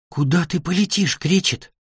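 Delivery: high, soft, small voice; little dragon's question. A narrator's reading. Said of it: "спросила солнышко". (тоненьким тихим голоском)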